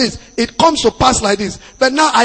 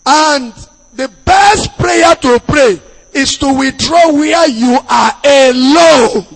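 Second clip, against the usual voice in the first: loud, higher preaching, hammered home; forceful preaching; 5 semitones higher, 5 dB louder than usual. Acted. Pastor warning the congregation, preaching loudly.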